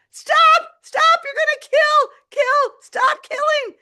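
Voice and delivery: high, loud desperate shouts; frantic yelling. Retold or imitated. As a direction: shout desperately to halt the chase. Yelling at chasing cats.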